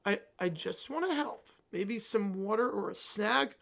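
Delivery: timid offer. Acted. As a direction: offer help carefully, afraid to set him off. Mom timidly offers help.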